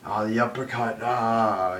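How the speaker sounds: mocking tag-team shout; mocking the Usos. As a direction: ham up their goofy entrance yell. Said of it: "they're messing around with their fucking dance".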